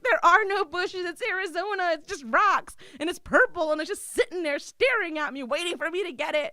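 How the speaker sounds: high, loud, exasperated rant about the purple dildo; loud panicked rant. Retold or imitated. Telling her friend on the phone there are no bushes, loud panicked rant. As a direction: wail it like you can't believe your luck.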